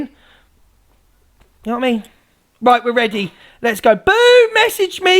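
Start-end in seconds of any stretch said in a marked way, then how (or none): 4.0-5.2 high, loud holler; calling out. Real, not acted. Streamer summoning Boo to message, calling out loudly. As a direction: sing out the name, then ease off.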